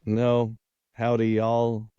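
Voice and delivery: quick playful Texas drawl on a greeting; Texas accent. Shown as a demonstration. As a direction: toss off a joking Texas cowboy greeting. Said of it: "And you don't have a Texas accent either".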